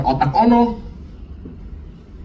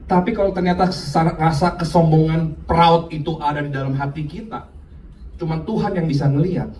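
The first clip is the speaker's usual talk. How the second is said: ordinary talk, nothing marked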